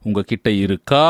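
closed question with "இருக்கா" lifted on its last vowel; inflected question. Shown as a demonstration. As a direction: push up on the ending "kaa" of "இருக்கா". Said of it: "there is an inflection in closed question".

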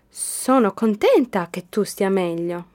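usual speaking voice

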